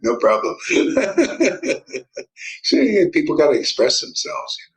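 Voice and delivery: loud laughing through the words; cackling, loud and amused. Real, not acted. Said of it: "He's laughing at her manic episode".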